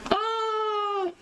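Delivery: thin, high-pitched squeal of nerves; nervous squeal. Real, not acted. Streamer freaking out before viewers, nervous squeal. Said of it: "I'm just so nervous".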